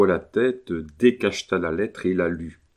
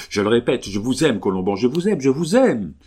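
loud, raised, building fervour; furious declaration; 4 semitones higher, 5 dB louder than usual. A narrator's reading. Carmélite repeats her love to Colomban, with a kind of fury. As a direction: declare it harder each time. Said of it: "avec une espèce de fureur".

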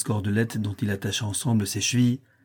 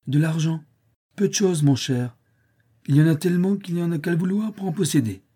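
breezy stranger; 6 semitones higher than usual. A narrator's reading. Stranger mocks money worries.

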